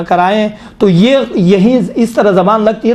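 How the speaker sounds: ordinary talk, nothing marked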